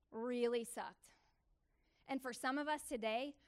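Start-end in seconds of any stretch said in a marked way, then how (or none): 0.1-1.1 "Really sucked" stretched out slowly; slow dragged emphasis; slow emphatic echo. Real, not acted. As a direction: drag out really sucked for weight.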